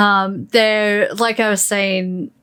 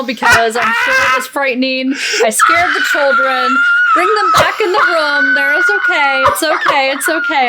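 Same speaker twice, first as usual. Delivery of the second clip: laughing; 6 semitones higher, 3 dB louder than usual. Real, not acted. Laughing at her own aegyo.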